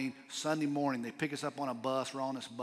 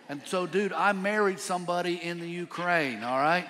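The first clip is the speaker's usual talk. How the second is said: excited punchline; 4 semitones higher, 7 dB louder than usual. Real, not acted. Punchline told to congregation.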